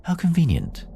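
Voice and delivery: put-on high-pitched little child figure; light, high voice of a moon child; child's voice. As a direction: lift the voice high for a small child. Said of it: "face of a child".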